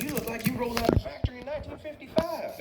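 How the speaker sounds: very high cartoon truck, amazed; Mater. Truck marvels at his vintage double, high character voice. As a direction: pitch it way up at ordinary volume. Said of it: "He's like".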